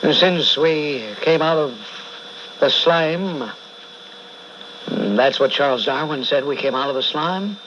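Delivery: preaching. Acted. Host preaches about human effort.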